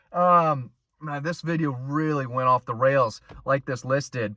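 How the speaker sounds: ordinary talk, nothing marked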